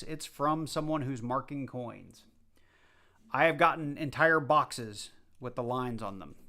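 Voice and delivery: ordinary talk, nothing marked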